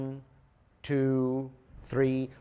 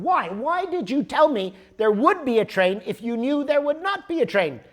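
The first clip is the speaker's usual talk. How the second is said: louder, higher retold outburst; angry; 9 semitones higher, 6 dB louder than usual. Traveler confronting the clerk, retold angry shout. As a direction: demand an answer, furious. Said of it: "I'm getting really angry at this point".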